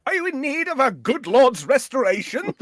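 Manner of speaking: very high, loud, outraged British disbelief; John Cleese impression. The priest as John Cleese would play him, outraged, in a very high British voice. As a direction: splutter like an affronted English gent. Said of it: "John Cleese's comedy".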